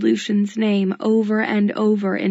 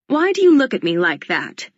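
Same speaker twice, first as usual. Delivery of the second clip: put-on sharp, raised snap at the girl; snapping; 3 semitones higher, 4 dB louder than usual. A narrator's reading. The Duchess snaps at her surrogate. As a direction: bite the question off, offended. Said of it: "she snaps".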